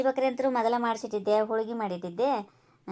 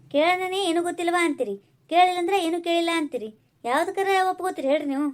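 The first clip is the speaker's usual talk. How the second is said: higher-pitched, heated comeback; exasperated retort; 5 semitones higher, faster than usual. Acted. Daughter-in-law protests that nothing pleases mother-in-law, exasperated. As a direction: argue back in exasperation.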